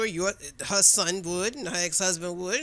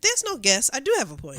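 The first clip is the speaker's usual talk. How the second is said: louder, higher, emphatic comeback; retold firm correction; 5 semitones higher, 5 dB louder than usual. Woman correcting coworker's grudging concession, emphatic comeback. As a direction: cut in and claim the point.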